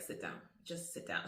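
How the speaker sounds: low, firm command from above; voice of God. Retold or imitated. God voiced telling her stop.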